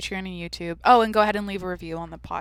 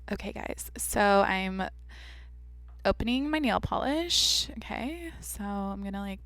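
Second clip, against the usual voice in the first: vocal fry demo; 6 dB quieter than usual. Shown as a demonstration. Showing listeners how a vocal fry voice sounds, creaky and soft. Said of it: "how to do vocal fry, for an example".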